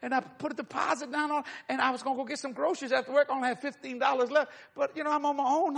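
high-pitched mock tearful voice; imitated crying. Young worker explaining her tight money, imitated tearful voice. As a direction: pour it out tearfully, high and running on. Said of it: "she starts crying".

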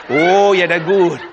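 loud, relishing Cajun imitation; hammed-up Cajun delight; Cajun accent. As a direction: savour it like a Cajun after a sip. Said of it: "a man that talk like that".

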